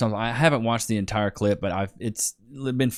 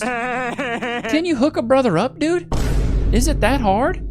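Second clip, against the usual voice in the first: slow, drawn-out begging, much higher; playful plea; 13 semitones higher, 3 dB louder, slower than usual. Pretend. Man pleading with his partner for morning sex, slow and whiny. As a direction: ham up a wheedling ask.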